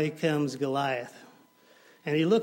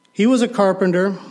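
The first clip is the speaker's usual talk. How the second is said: "He was a carpenter" much louder and higher, "was" hit; loud repeat; 4 semitones higher, 13 dB louder, faster than usual. Shown as a demonstration. The preacher driving the point home, loud. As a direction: repeat it, now proclaiming.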